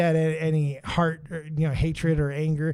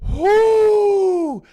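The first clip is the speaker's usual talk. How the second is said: excited whoo; 14 dB louder than usual. Real, not acted. Excited counting subs near goal.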